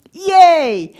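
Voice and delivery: loud, high cheer of delight; excited shout of praise; cheering yay. Real, not acted. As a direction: celebrate the right answer. Cheering a right audience answer.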